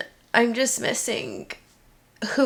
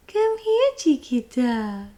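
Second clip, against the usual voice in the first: high coaxing call, slower ending; calling pet; 3 semitones higher, slower than usual. Real, not acted. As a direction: coax the kitten closer. Calling the cat again.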